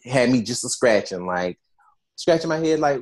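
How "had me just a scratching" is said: ordinary delivery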